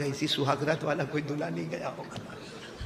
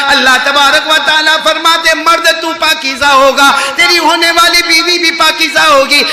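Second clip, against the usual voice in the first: full-force preaching, higher and louder; 12 semitones higher, 17 dB louder than usual. Acted. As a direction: drive the command home to the crowd. (پورے زور سے وعظ)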